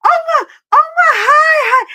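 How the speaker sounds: high, loud excited shout; screaming. Retold or imitated. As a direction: scream hello at a star down the mall. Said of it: "I'm screaming it so loud".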